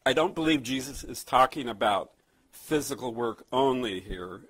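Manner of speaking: usual speaking voice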